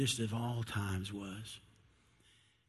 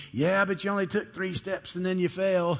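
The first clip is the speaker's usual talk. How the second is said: higher, louder jeer from the back; mocking Bartholomew; 6 semitones higher, 5 dB louder than usual. Bartholomew heckles Peter from the background, mocking. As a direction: needle him with a teasing jab. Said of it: "Bartholomew in the background saying".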